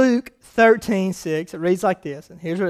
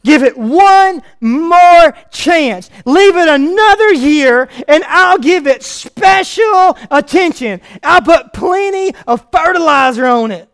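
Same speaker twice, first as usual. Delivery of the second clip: louder, slower, higher; full-force preaching; 6 semitones higher, 8 dB louder, slower than usual. Acted. Preacher pleading the parable to his church, loud. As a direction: pour it out to the congregation.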